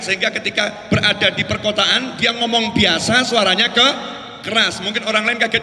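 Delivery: ordinary talk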